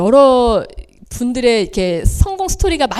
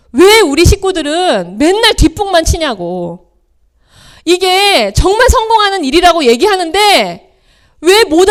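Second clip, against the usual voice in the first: higher, louder retold outburst at mom; retold yelling; 5 semitones higher, 5 dB louder than usual. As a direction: daughter finally yelling back at her mother, loud and high. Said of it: "아주 큰 소리로".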